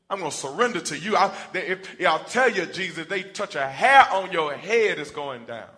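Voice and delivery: loud, high street-tough promise; loud ride-or-die vow; Peter's tough boast. Acted. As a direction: puff up like a loyal bodyguard. Preacher voicing Peter's boast.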